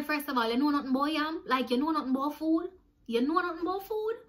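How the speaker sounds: high, incredulous questioning; indignant challenge. Retold or imitated. Challenging a housemate about binning the yam, indignant. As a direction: rise sharply into a disbelieving question.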